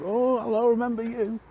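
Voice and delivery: high, bright mock surprise at recognising him; retold cheery greeting. Staff at the dealer greeting him, in a high mock-surprised voice. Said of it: "they go".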